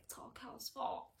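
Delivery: hushed whisper of blame. Retold or imitated. Mare blaming Cal, whispered. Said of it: "and she's like".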